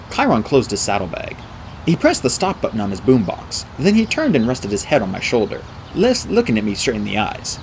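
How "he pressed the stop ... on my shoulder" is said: ordinary delivery, nothing marked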